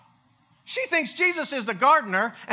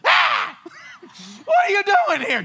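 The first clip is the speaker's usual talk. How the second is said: shrieked startled cry, much higher and louder; 11 semitones higher, 7 dB louder than usual. Retold or imitated. Scared disciples see Jesus in the locked room, startled shriek. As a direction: jump out of your skin, then blurt the question.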